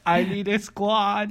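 high, slow, pleading voice; mock plea. Co-host begging listeners for teammates, high mock plea.

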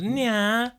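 high rap-line tag, "nya"; imitated "nya". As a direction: end on a high put-on "nya". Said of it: "she'll finish off with".

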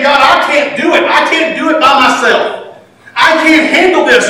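half-laughing, helpless prayer; laughing plea. Retold or imitated. Pastor voicing a helpless prayer, laughing. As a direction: laugh through "I can't do it". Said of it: "I'm saying".

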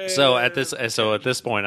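usual speaking voice

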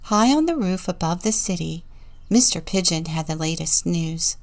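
plain reading voice

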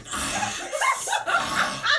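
very high breathy ah, ah; mock a loud, over-the-top moan; exaggerated moan. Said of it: "bitches who moan in vernacular".

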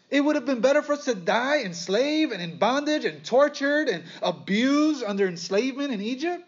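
high, mocking whine of a grumbler; a preacher mimicking the Israelites' complaint, high mocking whine; mock whining complaint. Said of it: "listen to the complaining of the Israelites".

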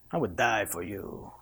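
low, soft lover's voice; romantic accent. Retold or imitated. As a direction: say it like a smooth suitor. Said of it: "You need to perfect that accent too".

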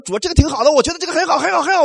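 high, pleased and self-assured; mock a believer who is sure of his own plan; lightly voiced, complacent churchgoer; smug self-assurance. Mocking a self-satisfied believer.

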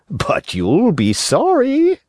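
high, slow fox warning; sly fox. Acted. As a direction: warn slyly, drawn out. Fox warns.

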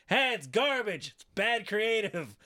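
slow, high mocking verdict; quoting CM Punk. CM Punk trashing the show, slow and high. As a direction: drag it out like a smug critic. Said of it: "he's like".

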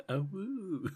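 high, laughing copy of a wolf howl; wolf howl imitation. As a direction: echo the howl high and giggly.

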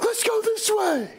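mock eager movie hero shouting; clueless movie character. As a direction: sound like a naive horror-movie hero picking the wrong path. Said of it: "everybody in the movie's like".

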